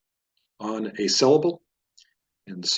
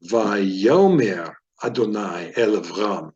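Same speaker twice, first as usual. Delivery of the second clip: slow "Vayomer Adonai El Avram", stressing YO, NAI and RAM; demonstrated word stresses; slower than usual. Shown as a demonstration. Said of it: "let's go ahead and put this together".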